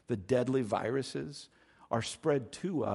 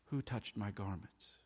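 "Who touched my garments?" asked much softer and gently; compassionate question; 2 semitones lower, 9 dB quieter than usual. Shown as a demonstration. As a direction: ask it with gentle compassion, not a command. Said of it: "It was a question of compassion".

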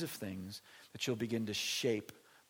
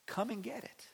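culture's sales pitch; 6 semitones higher, 5 dB quieter than usual. Retold or imitated. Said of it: "structured around this idea of".

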